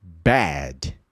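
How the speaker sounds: a low, clipped bad; model word. Shown as a demonstration. Teacher modelling for students, low and clipped. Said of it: "Fala comigo em voz alta".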